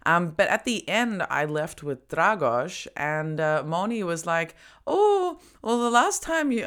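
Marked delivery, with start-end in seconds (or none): 4.9-6.7 imitating friend.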